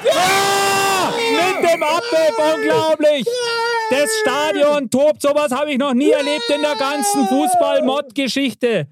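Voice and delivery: höher, lauter, langsam gedehnter Jubel des Kommentators; jubelnder Sportreporter. Pretend. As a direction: feier das Tor wie ein Stadionreporter im Rausch. Torjubel als Sportreporter. (begeisterter Stadionkommentator, höher und lauter)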